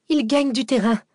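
high, urgent warning; anxious announcement. A narrator's reading. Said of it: "annonça Cécile dans un souffle". (annonce angoissée, voix aiguë)

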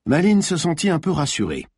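plain reading voice

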